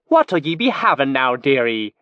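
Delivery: put-on bright, charming thick North Isle Moonshay accent; a jolly Moonshay pirate-barmaid offering a drink. A narrator's reading. Mimic playing Old Bess with a cheery Moonshay accent. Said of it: "with bright charm and a thick North Isle Moonshay accent".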